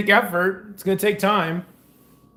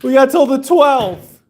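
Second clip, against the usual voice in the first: laughing; 5 semitones higher, 6 dB louder than usual. Real, not acted. Laughing at milk dates.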